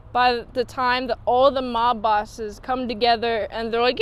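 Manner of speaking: usual speaking voice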